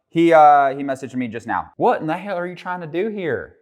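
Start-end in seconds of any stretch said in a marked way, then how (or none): 1.8-3.6 startled. Real, not acted. Catches someone creeping behind him.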